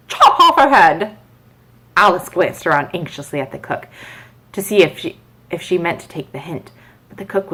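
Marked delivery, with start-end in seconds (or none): 0.1-1.2 loud, high, slow command; the Duchess barking a sudden order; Duchess orders beheading. A narrator's reading. Duchess snapping at Alice, loud and high. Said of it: "said the Duchess".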